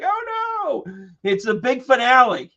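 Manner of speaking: high, hammed-up stage gasp; mock showman. Imagining herself as a Vegas showman, hammed-up. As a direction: camp Vegas magician announcing the finale. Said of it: "And I'd be like".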